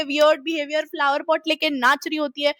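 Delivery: ordinary talk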